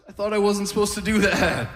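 put-on high-pitched, protesting old man; confused granddad. Granddad objecting to granddaughter about the glasses, confused high voice. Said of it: "he's all confused".

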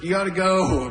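lightly voiced nagging Joseph; mock nagging dad. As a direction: hurry the kid along like a fed-up dad.

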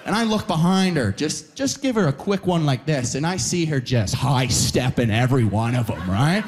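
ordinary delivery

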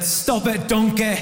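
badly faked Scottish accent. Comedian quoting Shrek at a funeral, bad Scottish accent. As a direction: quote it in a poor stab at Scottish. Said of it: "that was a very bad Scottish accent".

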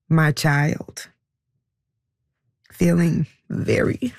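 slow, halting, holding back tears; pausing long between words as it sinks in; voice catching with emotion. Real, not acted. Mother admitting her child's hurt, halting and close to tears. Mother realizing daughter's betrayal.

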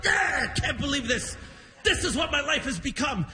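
mad and grumpy. Retold or imitated. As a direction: imitate a dad railing at his lot. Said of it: "he was all mad and grumpy".

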